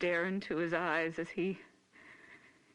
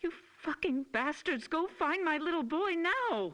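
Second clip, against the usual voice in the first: furious shout; 9 semitones higher than usual. Acted. Mother screams at police.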